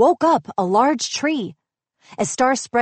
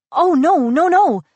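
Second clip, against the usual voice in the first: high, loud, urgent denial; hurried protest; 7 semitones higher, 6 dB louder than usual. A narrator's reading. Asha rushing to reassure Star, quick high protest. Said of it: "she said quickly".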